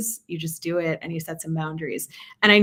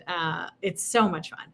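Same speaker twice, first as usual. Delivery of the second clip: ordinary delivery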